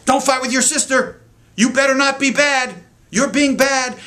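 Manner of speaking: put-on high, loud scolding of a child; scolding parent. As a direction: nag the kids like a frustrated parent. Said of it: "the first thing the parents say is".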